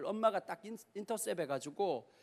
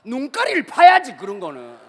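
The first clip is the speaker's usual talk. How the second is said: indignant outburst; 3 semitones higher, 16 dB louder than usual. Real, not acted. Preacher tells the youth what he'd do, loud mock-furious outburst. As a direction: blurt out in mock rage.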